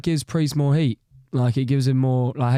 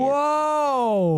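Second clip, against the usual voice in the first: high, long drawn-out whoa; taken aback; 13 semitones higher than usual. Real, not acted. Reacting to co-host's aggressive outburst.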